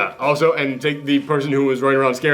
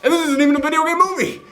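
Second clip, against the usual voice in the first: excited; 12 semitones higher than usual. Real, not acted. Keeps gushing about movie.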